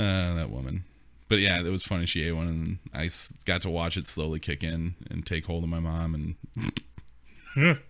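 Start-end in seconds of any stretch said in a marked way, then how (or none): none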